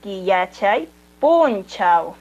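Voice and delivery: "kiyachay punchau" drawn out very slowly, the stress on "punchau"; slow Quechua word. Shown as a demonstration. Teacher giving the Quechua word for Monday, very slowly. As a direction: stretch every syllable of "kiyachay punchau". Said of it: "Lunes en quechua es".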